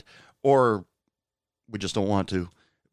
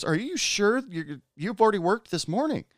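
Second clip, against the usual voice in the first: higher voice, a worried coworker checking on a friend; 11 semitones higher than usual. Retold or imitated. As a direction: pitch her up as she asks, concerned. Coworker voiced in retold scene.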